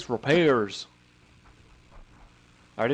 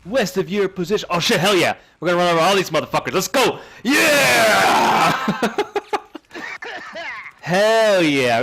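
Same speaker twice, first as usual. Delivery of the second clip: hyped gamer bro; 7 semitones higher, 5 dB louder than usual. Real, not acted. Quad bike charge into enemies.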